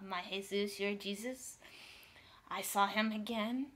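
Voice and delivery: normal talking voice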